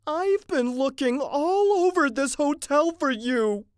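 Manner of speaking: high, loud, drawn-out complaint; scolding wife. Acted. Mrs. Mitty finding her husband, scolding loudly. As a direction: nag in a high, exasperated voice.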